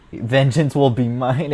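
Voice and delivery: normal talking voice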